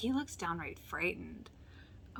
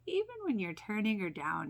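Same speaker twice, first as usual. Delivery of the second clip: usual speaking voice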